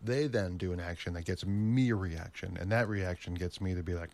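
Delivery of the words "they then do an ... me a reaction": ordinary talk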